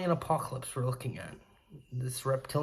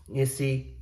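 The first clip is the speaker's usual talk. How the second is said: usual speaking voice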